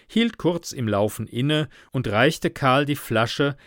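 usual speaking voice

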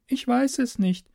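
put-on high girl's voice, a touch downcast; a little saddened. A narrator's reading. Captive girl admits she doesn't know, a little sad. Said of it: "klingt nun doch ein wenig betrübt".

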